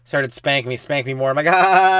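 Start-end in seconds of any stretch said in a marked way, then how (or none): 1.5-2.0 loud fake ha ha ha; mock laughing. Retold laughing at mom.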